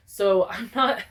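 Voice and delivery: normal talking voice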